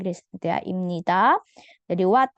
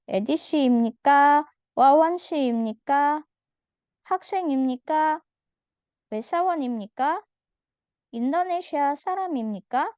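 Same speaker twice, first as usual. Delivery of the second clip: each imnika lifted into a raised question; rising question tune; 5 semitones higher, 4 dB louder than usual. Shown as a demonstration. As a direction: ask every line with the pitch climbing. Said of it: "nadanya harus naik ya".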